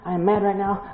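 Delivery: a hint of a pouting, fed-up husband; preacher voicing the husband in a pretend fight, mildly mock-mad; mock angry husband. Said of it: "Tim can say".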